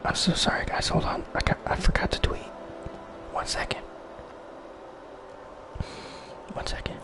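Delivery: soft unvoiced whisper. Real, not acted. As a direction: hush it close to the mic. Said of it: "I had to, I had to whisper".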